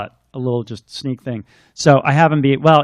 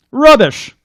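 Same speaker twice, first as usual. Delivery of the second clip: scoffing lord; 11 semitones higher, 8 dB louder than usual. Acted. Lord dismisses haunting.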